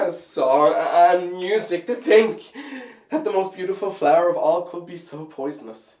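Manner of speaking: loud lament climbing in pitch; bitter reproach. Acted.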